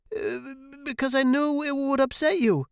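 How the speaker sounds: put-on very high, anxious kid voice; cowering son answering his father; frightened boy. A narrator's reading. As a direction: squeak out a nervous excuse.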